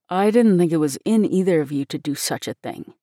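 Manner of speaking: mild, low-key reproach; gently reproving man's voice; reproachful. A narrator's reading. Minister disappointed in both children, mildly reproachful. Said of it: "said Dick reproachfully".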